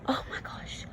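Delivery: unvoiced gasped oh my gosh; awed whisper. Real, not acted. Reader whispers in amazement at the reveal.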